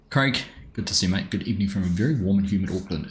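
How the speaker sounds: ordinary delivery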